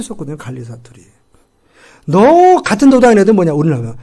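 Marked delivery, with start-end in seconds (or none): 2.1-3.5 louder accusing challenge; accusing shout. Acted. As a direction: call him out as one of them. Servant girl accusing Peter.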